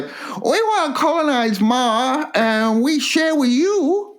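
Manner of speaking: sing-song promise in a put-on accent; imitating the Chinese colonists; mock Chinese voice. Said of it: "when the Chinese say".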